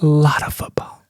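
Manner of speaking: whisper. Acted. Hosts whisper in turn.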